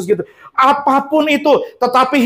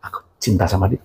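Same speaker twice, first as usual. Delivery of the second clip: much lower, sheepish mock plea; a coy lovesick believer bargaining with God; whiny believer; 14 semitones lower, 5 dB quieter, faster than usual. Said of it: "lovesick believer again". (orang Kristen merayu Tuhan, suara rendah malu-malu)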